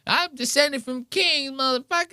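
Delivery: Tony Hinchcliffe. Retold or imitated.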